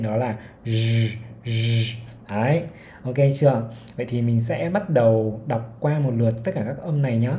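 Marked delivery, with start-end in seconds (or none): 0.6-1.9 z with the throat buzzing; voiced z. Shown as a demonstration. Teacher modelling the voiced z for learners. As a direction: buzz the z from the throat. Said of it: "cổ họng sẽ rung lên".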